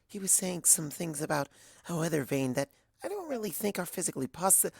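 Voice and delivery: shy and apologetic, barely there; timid Fluttershy. A narrator's reading.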